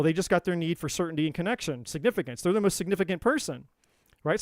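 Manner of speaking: ordinary talk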